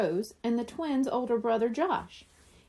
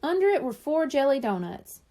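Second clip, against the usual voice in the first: ordinary delivery, nothing marked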